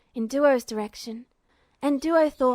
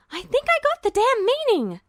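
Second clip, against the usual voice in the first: higher, irritated snap back; annoyed retort; 11 semitones higher, 4 dB louder than usual. A narrator's reading. Girl snapping at Duo, higher and annoyed. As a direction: bite back at him, annoyed.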